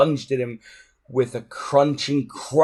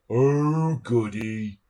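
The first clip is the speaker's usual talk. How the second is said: a brute's gloating delight; a bully pleased to fight on; gleeful bully; much slower than usual. A narrator's reading. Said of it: "said Dog's Breath happily".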